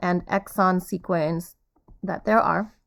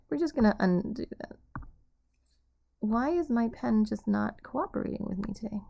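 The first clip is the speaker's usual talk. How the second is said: normal talking voice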